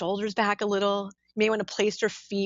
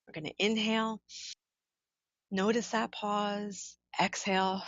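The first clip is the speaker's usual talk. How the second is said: guided breathing meditation; 3 dB quieter than usual. Acted. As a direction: lead them softly through each breath, unhurried. Leads a counted breathing meditation.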